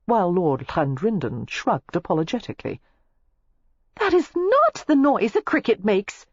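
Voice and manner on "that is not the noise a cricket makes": high, louder laughing protest; embarrassed protest. A narrator's reading. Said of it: "Daisy protested, flushing and laughing".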